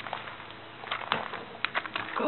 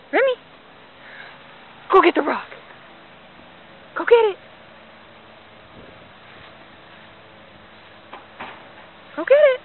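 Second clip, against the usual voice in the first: loud calls climbing higher each time; loud urging call; 6 semitones higher, 6 dB louder than usual. Real, not acted. Owner urging dog to chase the rock, loud building calls.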